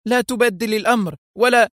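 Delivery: high outcry, cut short; heated high-pitched objection; shouted protest. A narrator's reading. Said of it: "صاحت".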